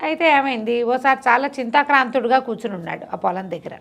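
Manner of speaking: ordinary delivery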